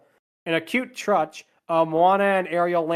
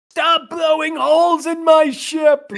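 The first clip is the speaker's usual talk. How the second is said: high, loud outraged shout; Captain Jack Sparrow; 11 semitones higher, 7 dB louder, slower than usual. Acted. Quoting a missing pirate captain's line to co-hosts, outraged yell. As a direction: yell it out, stretching the words. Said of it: "not having, uh, Captain Jack Sparrow here".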